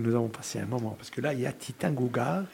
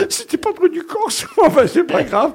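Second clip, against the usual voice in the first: high, loud, laughing through the words; real laughter; 10 dB louder than usual. Real, not acted. Host laughing at his own Corsican anecdote, amused. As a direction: crack up while talking.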